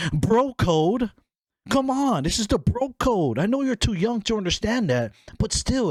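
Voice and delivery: very high, exasperated pleading; mock outraged protest. Sister appealing to brother's loyalty, mock outrage. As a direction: plead with a betraying sibling in high pitch.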